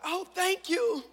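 high, slow awkward thank you. Retold or imitated. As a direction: stretch out an uneasy thanks. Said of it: "She was like".

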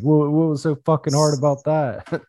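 high-pitched mock-incredulous jeer; gloating taunt. Climber turning to Patrick with hands up, gloating taunt. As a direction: replay the gloat, on the edge of laughter.

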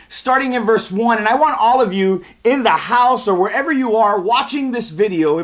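usual speaking voice

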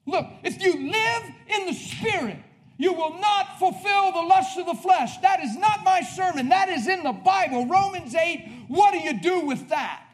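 high, loud and emphatic sermon; full-force preaching. Real, not acted. Preacher challenging the church with scripture, full-force preaching. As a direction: drive each phrase home from the pulpit.

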